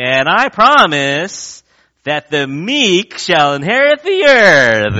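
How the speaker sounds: slow, loud, high politician's promise; hammed-up stump speech, drawn out; mock campaign speech. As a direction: boom it from the pulpit like a candidate. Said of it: "stand up there at the pulpit and say".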